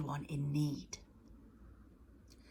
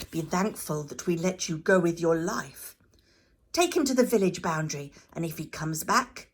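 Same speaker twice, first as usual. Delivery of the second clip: louder, commanding priestly rebuke; 10 dB louder than usual. Acted. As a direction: lay down the law. High priest orders removal.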